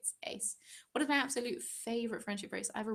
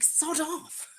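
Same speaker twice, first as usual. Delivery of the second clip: mock annoyance; 7 semitones higher, 3 dB louder than usual. Mock retort to annoying character.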